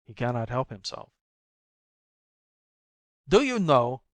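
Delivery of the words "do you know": menacing, louder and higher; threatening. A narrator's reading. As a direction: threaten her, pressing. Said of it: "he threatens her".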